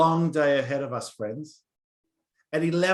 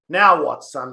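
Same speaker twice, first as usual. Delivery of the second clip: sharp, louder annoyed teacher; agitated teacher; 3 semitones lower, 9 dB louder than usual. Acted. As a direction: snap at the boy, irritated. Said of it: "she asked in an agitated verse".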